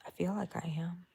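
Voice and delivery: soft unvoiced whisper. Real, not acted. Crocheter nearing her beginning spot, whispering hopefully. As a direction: hush it out as if not to jinx it.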